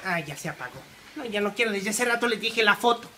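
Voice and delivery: ordinary delivery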